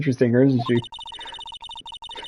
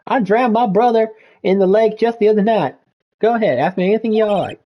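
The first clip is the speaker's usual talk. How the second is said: hammed-up Southern country woman, higher; 4 semitones higher than usual. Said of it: "that exaggerated drawl".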